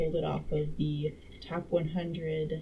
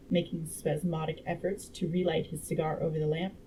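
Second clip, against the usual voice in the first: ordinary delivery, nothing marked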